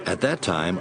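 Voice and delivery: ordinary talk, nothing marked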